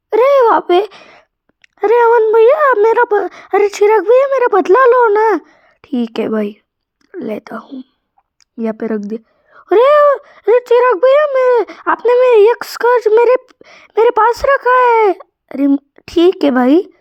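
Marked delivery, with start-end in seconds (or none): 0.1-5.4 put-on whiny little kid begging his big brother; high, pleading kid voice; high child voice. 9.7-15.1 put-on whiny little kid begging his big brother; high, pleading kid voice; high child voice.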